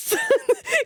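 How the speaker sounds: high giggle breaking in; real high-pitched giggle; laugh. Real, not acted. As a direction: burst out giggling briefly. Laughing at own story.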